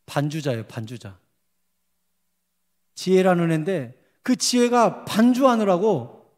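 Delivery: ordinary talk, nothing marked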